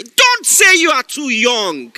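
loud, slow exhortation; shouted command. Acted. Preacher commanding the young listener, loud. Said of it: "This is a word for someone".

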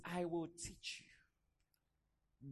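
very slow, soft promise; quiet, unhurried voice of a patient teacher; gentle reassurance. Retold or imitated. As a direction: draw it out softly and reassuringly. Voices God's reassurance to him.

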